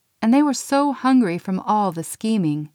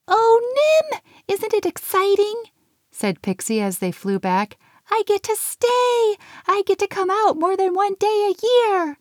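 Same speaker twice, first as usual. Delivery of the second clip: very high giddy elf voice; excited elf voice; 14 semitones higher than usual. A narrator's reading. Pixie telling Nim her news, very high and giddy. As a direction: squeal it with delight.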